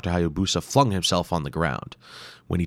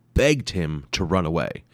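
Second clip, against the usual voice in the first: usual speaking voice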